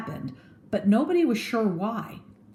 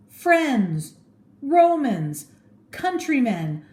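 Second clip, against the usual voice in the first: high, loud and slow plea to the crowd; begging call; 9 semitones higher, 4 dB louder, slower than usual. Acted. Mark Antony begging the crowd for a hearing, loud. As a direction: beg the crowd to listen. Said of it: "he begged".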